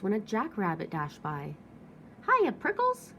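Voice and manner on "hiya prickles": much higher, louder perky hello; cheerful shout. Acted. Jackrabbit shouting a cheeky nickname at the cactus. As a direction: holler a teasing greeting. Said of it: "she shouted".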